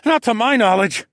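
high, loud answer under fire; shouted reply. A narrator's reading. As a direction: call back loudly from cover. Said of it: "after we both dove for cover".

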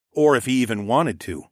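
ordinary delivery, nothing marked

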